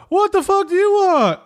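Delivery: irritated, high-pitched whining comic voice; Jerry Seinfeld. Acted. Crucified Jesus snaps at nosy visitor, high-pitched and irritated. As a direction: pitch it up into exasperated, drawn-out complaint. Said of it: "he's doing a Jerry Seinfeld impression".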